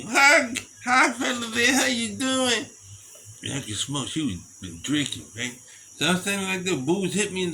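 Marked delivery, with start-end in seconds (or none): 0.1-2.7 put-on high shouted drunk woman's greeting; drunk blonde. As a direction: squeal it like a tipsy woman at the bar.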